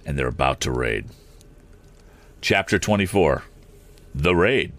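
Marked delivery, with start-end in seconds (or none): none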